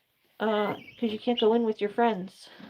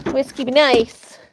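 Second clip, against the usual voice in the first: firm warning; 2 semitones higher, 8 dB louder, slower than usual. Real, not acted. Scolding a bird.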